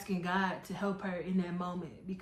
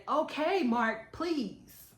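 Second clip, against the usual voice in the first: higher, pleading imitation of a girl; retold begging; 6 semitones higher, much slower than usual. Girlfriend begging Mark to stop, imitated pleading. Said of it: "begging him to stop".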